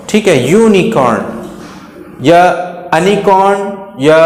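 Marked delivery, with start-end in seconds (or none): 2.9-3.8 अनिकॉर्न starting with a short uh; wrong pronunciation. Shown as a demonstration. Teacher warns against a misreading, अनिकॉर्न with uh. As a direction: open अनिकॉर्न on uh, as a mistake. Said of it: "नहीं पढ़ेंगे".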